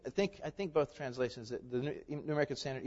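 normal talking voice

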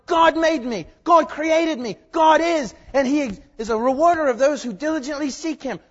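loud, high proclamation; snowflake crying out. Retold or imitated. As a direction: shout it like all creation proclaiming. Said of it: "snowflake is crying out".

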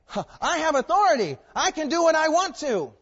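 high, loud mocking of a boastful man; mock proud boast. Preacher mocks man's boast of authority, high and loud. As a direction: puff up like an arrogant man.

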